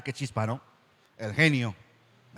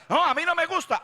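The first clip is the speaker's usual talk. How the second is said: put-on much higher, childish no; petulant refusal; 12 semitones higher than usual. Preacher voices a member refusing the word, petulant.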